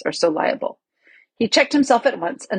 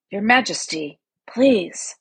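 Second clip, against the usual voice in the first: lightly voiced sleepy bear; a hint of drowsy thickness; thick, sleepy voice; slower than usual. A narrator's reading. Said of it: "a thick, sleepy voice said".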